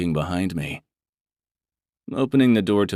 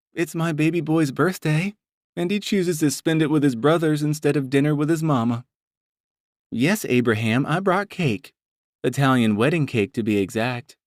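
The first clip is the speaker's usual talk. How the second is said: higher motherly voice, a little teasing; Mama voice; 8 semitones higher than usual. Acted. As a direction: play the doting mother chiding her son. Mama's voice in audiobook.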